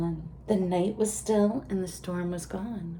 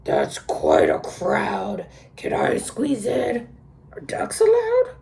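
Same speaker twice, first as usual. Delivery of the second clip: duck voice; 3 semitones higher, 7 dB louder than usual. A narrator's reading. The duck asks to squeeze in, quirky duck voice. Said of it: "said the duck".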